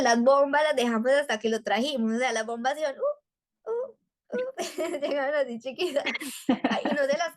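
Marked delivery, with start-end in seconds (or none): 3.0-4.5 very high little hoots; high-pitched imitation of deflating balloons; imitated shrinking noise. Mother showing how nursery balloons shrank, high imitated noise.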